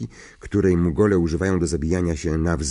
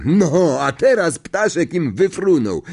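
high, louder character voice; gossipy conductor; 9 semitones higher, 5 dB louder than usual. Acted. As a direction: crow about the escape in character. Stan keeps gossiping in character.

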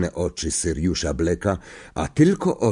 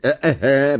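wordless grunted agreement, raised a little; grunting driver; 6 semitones higher, 4 dB louder, faster than usual. A narrator's reading. Driver answers the chatty conductor, grunting. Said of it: "mruknął kierowca".

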